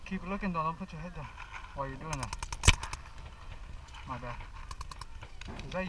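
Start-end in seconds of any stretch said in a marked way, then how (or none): 0.1-4.4 hushed. Real, not acted. Hiding, quiet instructions.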